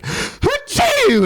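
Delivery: high squeaky mock sneeze; hammed-up comic sneeze; exaggerated fake sneeze. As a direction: sneeze big and high for the joke. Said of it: "have a big sneeze".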